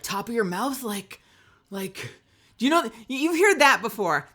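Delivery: normal talking voice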